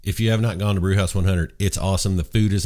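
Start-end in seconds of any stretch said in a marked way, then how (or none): none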